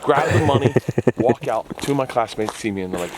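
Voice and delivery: ordinary delivery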